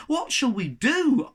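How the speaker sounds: high, slow worried plea; anxious question. A narrator's reading. Cabin boy asking the captain in alarm. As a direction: ask it in alarm. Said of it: "asked Shen".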